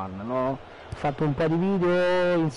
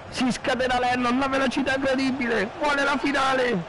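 play-by-play; 9 semitones higher than usual. Real, not acted. Live play-by-play of own match.

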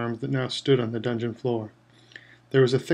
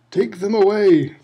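higher, louder, slow roar; 7 semitones higher, 7 dB louder, slower than usual. A narrator's reading. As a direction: roar the order, spit flying. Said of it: "Crouch roared at the Dementors".

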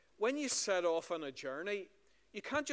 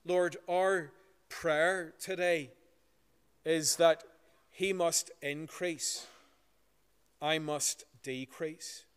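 slow, louder prayer voice, reverent; public prayer; 5 dB louder than usual. Acted. Minister praying aloud before the congregation, slow and reverent. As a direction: lead the church in prayer, slow and strong.

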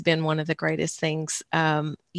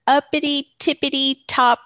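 playful singsong; 7 semitones higher, 5 dB louder than usual. Real, not acted. Playful rhyming place description.

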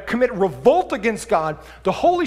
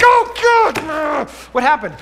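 short-tempered outburst; 7 semitones higher, 6 dB louder than usual. Retold or imitated. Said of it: "it's like".

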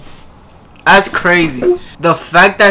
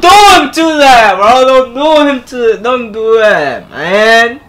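pained protest; 5 semitones higher, slower than usual. Real, not acted. Listener protests to the singer's partner over a hurtful song, pained protest. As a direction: cry out in dismay, then sink back down. Upset reaction to the song's story.

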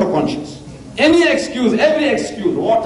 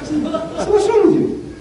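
slow, higher, mock-incredulous scold; mock scolding question; 12 semitones higher, slower than usual. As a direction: tease them slowly like a scolding elder. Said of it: "I was supposed to ask".

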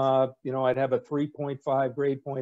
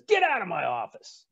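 higher, curt imitated order; curt dismissal; 5 semitones higher than usual. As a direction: throw him out briskly. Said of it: "Rickover says".

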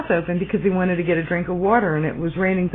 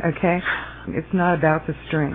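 usual speaking voice

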